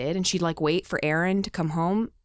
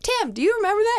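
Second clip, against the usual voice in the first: mom pressing; 13 semitones higher, 4 dB louder, faster than usual. Retold or imitated. Mom questioning dad.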